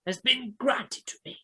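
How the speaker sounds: lightly voiced zealous believer; fervent [name]. [name] proclaims the egg a gift, fervent.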